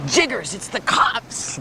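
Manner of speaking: high, slow cry of alarm; mock-alarmed warning. Friend warning that police are coming, hammed-up alarm. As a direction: sound the alarm, drawn out and high.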